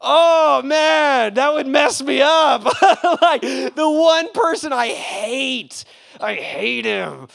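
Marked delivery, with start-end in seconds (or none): 2.7-3.2 amused laugh. Real, not acted. Imagining the enemy walking in.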